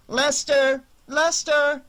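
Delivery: high, loud call for a lost dog. A narrator's reading. Annie calls into the night for Lester, calling out. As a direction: shout the name out into the night. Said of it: "Annie's voice filled the night".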